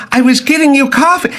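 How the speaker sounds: mock indignant woman; flustered secretary. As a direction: cry out like a harried fifties wife. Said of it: "And she'd go".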